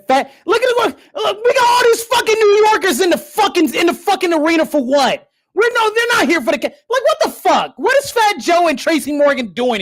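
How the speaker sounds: furious fan ranting; outraged rant. Real, not acted. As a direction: rant at the crowd in disbelief. Outraged at New Yorkers in arena.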